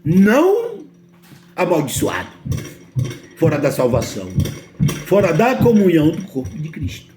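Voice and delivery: ordinary talk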